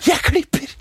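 mocking echo.

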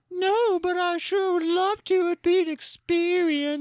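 high, slow swooning falsetto; dreamy mayor. Retold or imitated. Dreaming mayor answering Martha, high slow swooning.

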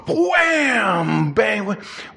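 drawn-out high WHAM like a door bursting; big sound-effect exclamation; explosive imitated bang. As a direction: hit it like a sudden crash.

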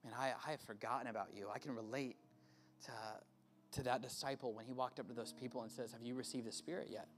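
soft, hushed prayer-time voice; quiet altar call. Acted. Pastor guiding students with bowed heads, soft and hushed. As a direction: gently guide bowed heads into listening.

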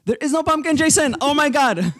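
high, loud, fed-up yell; exasperated shout. Real, not acted. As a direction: yell it at him in disbelief. Exasperated outburst at Jason.

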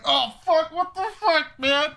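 the guy being peed on yelps in disbelief; shocked victim. Retold or imitated. Victim waking up covered in piss, high shocked yelp. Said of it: "And he's like".

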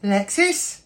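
high, astonished questioning; high-pitched, loud recognition; character recognising a friend. Acted. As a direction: call out in hopeful disbelief. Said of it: "Tem goes".

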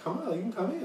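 high-pitched insistent coaxing; creepy invitation. Retold or imitated. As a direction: imitate a stranger luring someone inside. Acting the man's insistent invitation.

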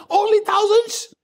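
high, loud, slow outraged Only thousands; king scoffing in high disbelief; indignant king. Acted. Saul outraged at the women's song, high and loud. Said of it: "He said".

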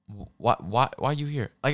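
usual speaking voice

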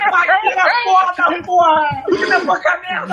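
excited yelling. Real, not acted. His guess scores, celebrating.

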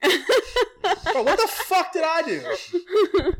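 high, loud, laughing disbelief; laughing outrage. Real, not acted. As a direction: laugh through the indignation. Laughing about getting punched.

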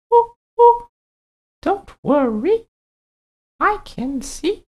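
a friendly owl, high and hooting. Acted. Owl figure voiced.

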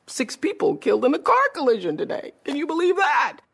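high-pitched, astonished news read; surprised newsreader. Retold or imitated. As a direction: react to the news live, genuinely shocked. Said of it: "I wanted to be surprised".

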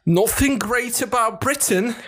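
put-on high, slow posh British English accent; heavy English accent. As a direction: overdo a thick BBC English accent. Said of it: "ilyen atomdurva angol akcentussal".

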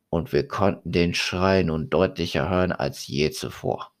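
plain reading voice

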